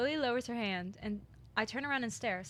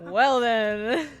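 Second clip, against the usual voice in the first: laughing; 2 semitones higher, 15 dB louder than usual. Real, not acted. Laughing fit.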